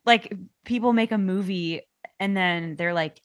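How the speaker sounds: normal talking voice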